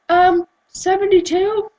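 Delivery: put-on drawn-out, high American accent; loud, exaggerated American reply.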